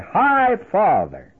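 slow, teasing high-pitched jeer; mocking laugh. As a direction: traders mocking the old name, laughing as they say it. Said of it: "They all begin to laugh and say".

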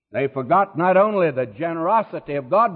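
normal talking voice

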